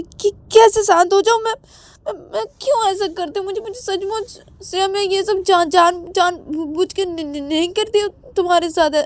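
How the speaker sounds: can't calm down, words catching; anxious stutter. Acted. Shruti still panicking. (बेचैन हकलाहट)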